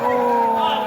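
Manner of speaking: ordinary talk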